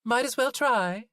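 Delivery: Zoe, haggling with the butcher; Zoe's voice. A narrator's reading. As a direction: brush it off as Zoe. Zoe waves him off.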